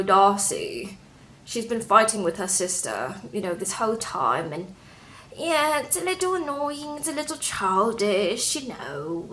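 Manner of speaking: mock British boyfriend voice; softly spoken imitation British accent. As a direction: put on a mild British accent. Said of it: "Tom is from Britain".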